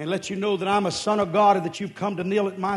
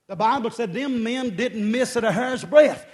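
ordinary delivery

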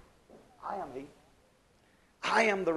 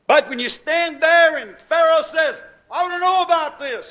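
raised, loud and high demand; loud challenge; 7 semitones higher, 10 dB louder than usual. Retold or imitated. Preacher voicing Pharaoh's demand, loud and high.

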